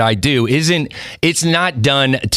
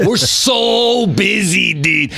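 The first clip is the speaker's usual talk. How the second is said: hammed-up Jeff G voice, higher, slurring words together; Jeff G impression; 5 semitones higher than usual. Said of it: "It'd be like".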